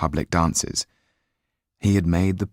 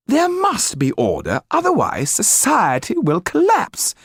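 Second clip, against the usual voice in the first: much higher, louder, imperious aristocrat; shrill royalist lady insisting on order; Madame Clermont; 4 dB louder than usual. Acted. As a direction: lecture them on their place. Narrator voices Madame Clermont.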